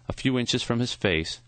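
ordinary delivery

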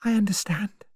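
high, whispery acknowledgement; breathy, quiet understanding; said quietly. A narrator's reading. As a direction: answer in a hushed, high half-whisper. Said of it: "Vera said quietly".

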